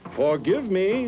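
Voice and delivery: a grand god mildly excusing himself; god Encong. Acted. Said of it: "said Encong".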